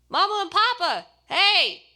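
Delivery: loud, high, joyful greeting; excited exclaim. Acted. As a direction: call out happily across the gym. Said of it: "I exclaimed".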